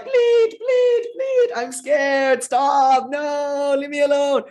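very high, crying, shouted bleats of a scared sheep; a terrified little lamb crying out to be left alone; frightened bleating lamb. Retold or imitated. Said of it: "the deluded lion was like".

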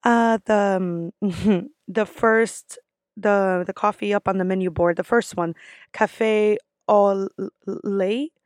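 halting, stumbling, slightly sheepish repeat order; unsure. Acted. Customer repeating the order to barista, unsure and halting. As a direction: drag out "au lait" uncertainly, rising. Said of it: "I'm not confident in how I'm saying".

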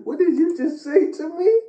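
high-pitched incredulous imitation; mock disbelief. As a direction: squeal it in mock outrage at what was said. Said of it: "They look at you like".

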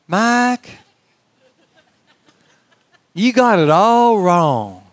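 put-on slow, drawn-out South Carolina accent, lightly done. Older man corrects young pastor after church, slow South Carolina accent. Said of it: "I don't do a South Carolina accent very well".

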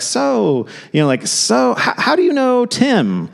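bright, high party chatter voice; chirpy small talk. Retold or imitated. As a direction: put on the eager small-talk host.

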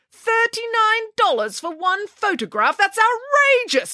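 high, slow, incredulous outrage; indignant, high-pitched exclamation; outraged declaration. A narrator's reading. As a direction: draw out the price in high-pitched disbelief. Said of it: "declared Nanny Piggins".

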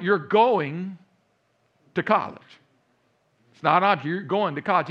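lightly acted parental ultimatum, leaning on each phrase; insistent parents. Parents overrule their son about college, firmly insistent.

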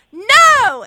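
loud, high-pitched "no" shout; rude retold shout. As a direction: blurt out a rude, emphatic refusal. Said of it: "How rude was I?".

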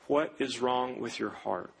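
low, quiet, serious doctor voice; grave cardiologist. Retold or imitated. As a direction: ask it like bad news is coming. Cardiologist questions the patient.

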